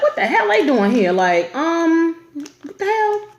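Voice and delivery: mock shocked mom. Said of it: "she was like".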